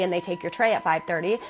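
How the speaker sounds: ordinary talk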